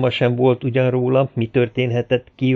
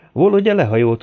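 ordinary delivery

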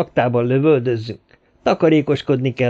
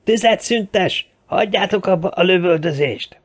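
shouted command; 9 semitones higher than usual. A narrator's reading. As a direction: call it out across the men. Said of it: "ordította". (magas hangú, elnyújtott parancskiáltás)

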